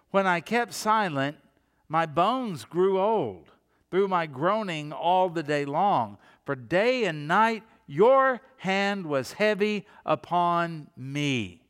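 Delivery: slow, heavy psalm reading; solemn psalm read; scripture reading. Acted. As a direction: read it like a lament, slowly.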